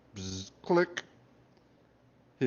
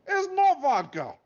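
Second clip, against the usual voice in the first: high, louder, slow outraged Russian guard; guard lamenting there was no vodka, in a Russian accent; 8 dB louder, slower than usual. Acted. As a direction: wail the betrayal in a Russian accent. Guard's betrayal retold.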